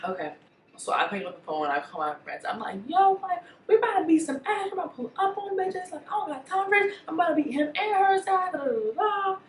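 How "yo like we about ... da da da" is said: rally the crew for a fight; hyped up. Retold or imitated. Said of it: "I'm calling my friends all hype".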